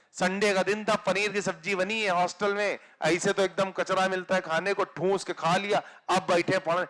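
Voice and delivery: ordinary talk, nothing marked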